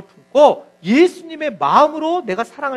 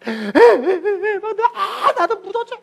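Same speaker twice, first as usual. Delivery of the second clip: very slow, high, half-laughing sob; mock-crying husband begging to be buried; crying plea; 9 semitones higher, much slower than usual. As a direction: drag the words out through tears and laughter. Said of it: "계속 울면서 그러더라는 거예요".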